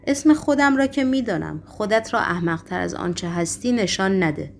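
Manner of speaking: plain reading voice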